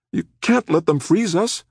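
high, alarmed appeal; shuddering demand. A narrator's reading. Rachel to the attorney about the freezers, frightened. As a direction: insist fearfully, pitched right up. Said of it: "Rachel demanded, shuddering".

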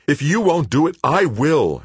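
high, loud vicious snarl; feral snarling. A narrator's reading. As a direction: snarl it with fury. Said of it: "she snarled".

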